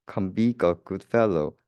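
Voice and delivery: ordinary delivery, nothing marked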